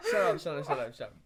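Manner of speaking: ordinary talk, nothing marked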